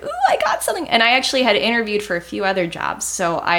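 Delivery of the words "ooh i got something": squealing high thought; a giddy squeak of hope; excited. Retold or imitated. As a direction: voice leaps way up with glee. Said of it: "In my brain, I'm like".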